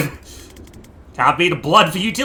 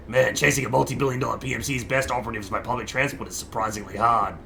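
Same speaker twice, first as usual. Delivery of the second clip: lower, softer, brisker redo in the proper voice; right character voice; 7 semitones lower, 8 dB quieter than usual. Acted. Player redoes the line in the intended character's voice. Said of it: "Sorry, that was the wrong guy".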